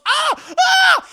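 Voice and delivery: very high, very loud shriek of agony; burning scream. Retold or imitated. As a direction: scream as the sun burns you. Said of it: "he goes".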